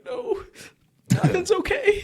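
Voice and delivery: mock despair. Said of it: "And you're like".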